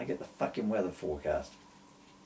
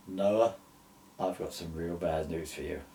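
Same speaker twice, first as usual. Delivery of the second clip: God's voice; 6 semitones lower, 3 dB quieter than usual. A narrator's reading. God voiced in retold story.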